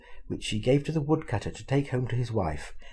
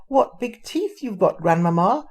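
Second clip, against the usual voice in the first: put-on high, loud scared girl; frightened little girl; 8 semitones higher, 5 dB louder than usual. A narrator's reading. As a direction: cry out in fright. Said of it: "she cried, now very frightened".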